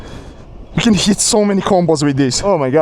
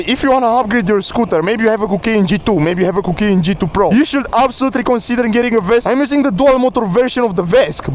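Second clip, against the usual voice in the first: ordinary talk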